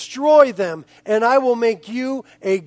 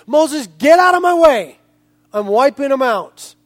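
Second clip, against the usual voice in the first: angry God; 3 semitones higher, 7 dB louder than usual. Acted. God ordering Moses aside, loud and angry. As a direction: bark it at Moses like a furious God. Preacher voicing furious God.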